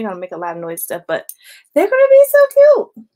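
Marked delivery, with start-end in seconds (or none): 1.7-3.1 gushing. Real, not acted. Gushing over stacked bracelets.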